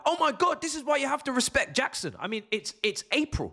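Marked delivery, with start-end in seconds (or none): 0.0-2.2 mocking fans. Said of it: "people are like".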